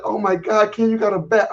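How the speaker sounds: high-pitched mimicry of her alarm; imitated shock. Woman's shocked reaction retold.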